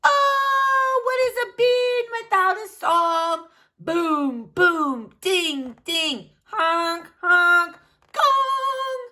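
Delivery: high, loud shouted jam; wild band noise. Acted. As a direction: bang out a noisy band. Bean noisy one-bean band.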